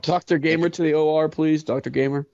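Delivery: page a doctor over the intercom; hospital page. Pretend. Paging a doctor to the O R, mock hospital announcer.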